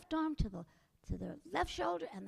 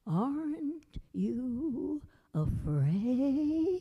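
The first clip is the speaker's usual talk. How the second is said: louder, very slow eerie drawl; spooky skeleton; 8 dB louder, much slower than usual. A narrator's reading. Skeleton tries to scare Jack, very slow and loud. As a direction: stretch it out to frighten him. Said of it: "said the skeleton".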